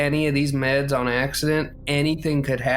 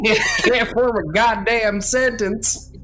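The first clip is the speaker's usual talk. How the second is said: real laugh, words higher and slow; laughing through it; 8 semitones higher, 3 dB louder, slower than usual. Real, not acted. Player mocking her own fumbled line, laughing. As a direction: laugh while mocking yourself.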